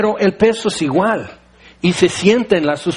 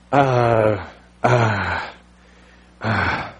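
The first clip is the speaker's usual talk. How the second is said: much lower, fading sounds of loss for words; speechless stammer; 8 semitones lower, 3 dB quieter, much slower than usual. Retold or imitated. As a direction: falter with nothing to say. People speechless before God's command. (balbuceo sin palabras, cada vez más grave y apagado)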